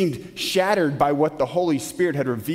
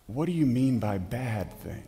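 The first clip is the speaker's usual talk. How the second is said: soft, lower-pitched careful question; gentle, respectful; 4 semitones lower, 6 dB quieter than usual. Retold or imitated. Preacher questioning the young man about his words, gently and respectfully. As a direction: ask gently, without judging. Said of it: "I very gently and respectfully said".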